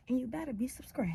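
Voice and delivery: fast whispered warning; quick teasing whisper; playful whisper. Real, not acted. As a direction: whisper it as a sly threat.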